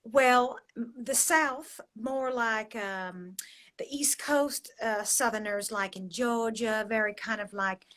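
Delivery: soft, feminine Georgia accent; Southern accent. Shown as a demonstration. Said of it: "a soft, feminine accent".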